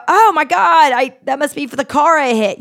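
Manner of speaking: loud, high oh-my-God dawning; high startled exclamation; sudden realization. Retold or imitated. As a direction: blurt out the realization. Realising the dirty face.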